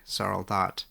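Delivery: usual speaking voice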